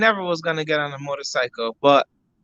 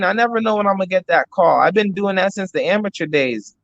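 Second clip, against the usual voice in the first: ordinary talk, nothing marked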